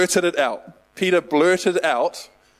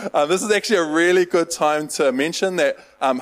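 normal talking voice

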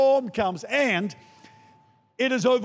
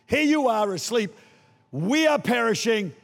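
ordinary talk, nothing marked

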